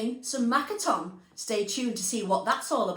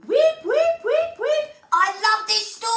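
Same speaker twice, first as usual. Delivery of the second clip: much higher, louder squeaky puppet voice; an excited little guinea pig bouncing with joy; high excitable puppet squeal; Christopher the guinea pig; 12 semitones higher, 8 dB louder than usual. Acted. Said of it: "it's Christopher and my turn".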